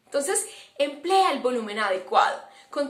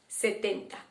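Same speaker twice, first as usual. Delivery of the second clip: soft hint; 2 semitones lower, 6 dB quieter than usual. Shown as a demonstration. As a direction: drop Setenta softly as a teaser. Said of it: "Setenta por ciento". (Setenta dicho suave y bajo)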